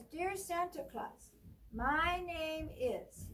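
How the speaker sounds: ordinary talk, nothing marked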